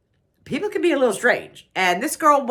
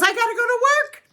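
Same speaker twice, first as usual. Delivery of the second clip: high mock-drunk announcement; lightly voiced drunk woman; tipsy woman quoted; 14 semitones higher, 3 dB louder, faster than usual. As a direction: chirp it out like a tipsy friend. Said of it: "She goes".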